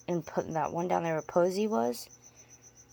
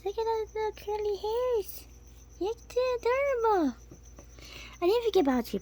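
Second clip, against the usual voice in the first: much higher, squeaky pet voice; 12 semitones higher, 3 dB louder than usual. Real, not acted. Owner gushing over her tiny tarantula in baby talk. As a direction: gush over a cute pet.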